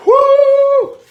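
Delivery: long high-pitched whoop at the burn. Real, not acted. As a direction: let the heat out in a piercing whoo. Reacting to burning spicy food.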